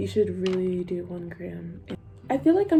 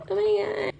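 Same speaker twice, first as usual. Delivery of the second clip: shrill shocked gasp, much higher; shocked family member; 12 semitones higher than usual. Retold or imitated. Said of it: "they're gonna be like".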